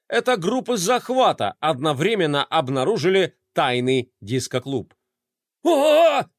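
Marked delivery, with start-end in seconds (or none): 5.6-6.3 high, loud squeal of alarm. A narrator's reading. Flay squeals as raids uncover the disco club. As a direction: shriek like a startled kid. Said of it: "завизжал Флай".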